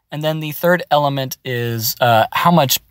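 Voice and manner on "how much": ordinary delivery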